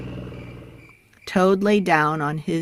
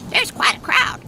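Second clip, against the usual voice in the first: very high, airy duck voice; squeaky duck; faster than usual. A narrator's reading. Duck asks to squeeze into the crowded garage, very high. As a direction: squeeze the words out like a tiny duck. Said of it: "said Duck".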